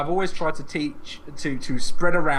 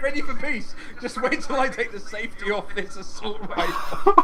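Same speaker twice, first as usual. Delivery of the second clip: mock gunman; 6 semitones higher than usual. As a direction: play the gunman for laughs.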